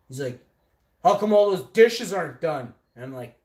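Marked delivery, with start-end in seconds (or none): 1.0-2.7 loud, higher scolding demand; mock a boss yelling down the phone; imitated angry boss. Boss phones young worker about undone dishes, loud scolding.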